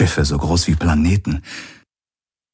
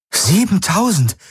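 much higher incredulous exclamation; astonished cry; 12 semitones higher than usual. Acted. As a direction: shoot up in disbelief at the number. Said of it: "rief Kirai aus".

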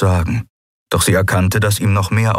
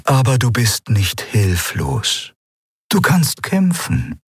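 very slow, measured apparition; drawn-out, higher-pitched spirit voice; otherworldly eidolon, calm; 4 semitones higher than usual. Acted. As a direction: answer calmly, stretching each word.

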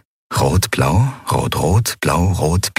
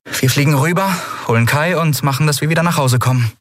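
put-on higher, brisk young captain, speeding up at the end; a lightly voiced captain hurrying her crew; captain Endriel; 8 semitones higher, faster than usual. A narrator's reading. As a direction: rush the plan out with eager resolve. Endriel's voice, held.

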